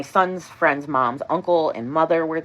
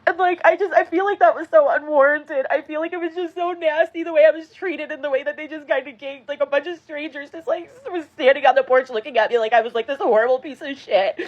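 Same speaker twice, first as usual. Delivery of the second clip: high, upset voice near tears; real tearful complaint; 13 semitones higher, 4 dB louder than usual. Real, not acted. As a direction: speak through the hurt, still crying. Upset crying rant.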